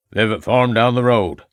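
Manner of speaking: lightly voiced old country farmer, a touch gruff; old farmer answering the police, lightly voiced. A narrator's reading. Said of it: "said the old man".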